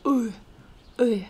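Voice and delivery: U as a lone model vowel, lips not spread; U sounded separately for learners; unsmiling Russian vowel. Shown as a demonstration. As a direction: say U with no smile. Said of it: "we do not smile".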